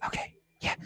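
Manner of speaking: soft whispered aside. Shown as a demonstration. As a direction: whisper it, barely voiced. Whispering after whisper remark.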